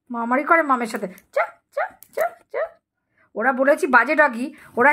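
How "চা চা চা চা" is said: very high, thin calling sound; high squeaky call to coax a pet; squeaky dog call. Real, not acted.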